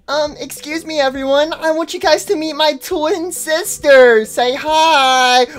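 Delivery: high, sweet little character voice; timid high-pitched figure; polite introducer. Acted. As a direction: present your twin in a small high voice. Figure introducing twin sister.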